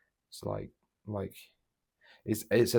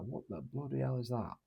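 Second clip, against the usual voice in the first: imitating a Yorkshire dad, higher and soft; hushed, higher Yorkshire-accented quote; thick Yorkshire accent; 5 semitones higher, 6 dB quieter than usual. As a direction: put on dad's Yorkshire accent, pitched up but quiet. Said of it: "thick Yorkshire accent".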